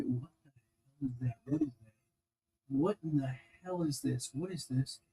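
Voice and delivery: quietly muttering what the hell under the breath; puzzled mutter. Real, not acted. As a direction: mutter to yourself, baffled by the find. Puzzled at odd piece in bin.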